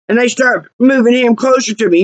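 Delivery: usual speaking voice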